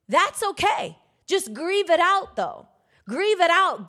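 high, loud exhortation to grieve; preaching at full force. Acted. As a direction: push it out to the congregation like a preacher in full flight. Preaching on grief, rising.

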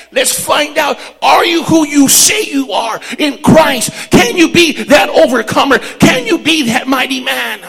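loud, high preacher shout building to a peak; shouted sermon climax; full-force preaching. Acted. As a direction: thunder it at the congregation.

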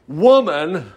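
ordinary talk

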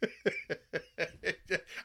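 a soft rising burst of laughter; amused chuckle; real laugh. Real, not acted. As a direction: laugh at the memory of the granny. Laughing at granny story.

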